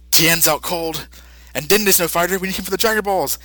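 usual speaking voice

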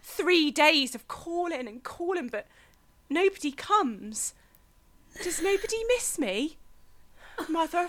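lightly voiced lonely girl trapped below; a hint of a stranded young woman, sighing; Clara in the well. Monologue performer to judges, a mild lonely lament.

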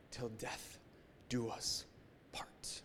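softly whispered wedding vow. Retold or imitated. Pastor recalling his wedding vow, whispered. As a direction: breathe the vow almost silently.

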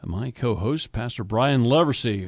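upbeat radio host read; radio announcer. Acted. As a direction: introduce the show like a broadcaster. Radio host segment break.

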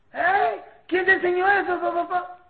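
loud, high, indignant demand; parent's scolding. Acted. Parent confronting a child over a swear word, sharp loud scolding.

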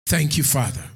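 ordinary talk, nothing marked

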